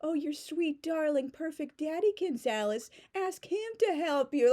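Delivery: high, sing-song schoolyard teasing; mock a classmate's sappy journal; jeering high voice; mocking taunt. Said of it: "they would make fun of teenagers".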